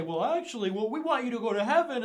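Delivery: mock excuse-making. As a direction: parody a wheedling justification. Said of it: "convoluted workaround to say".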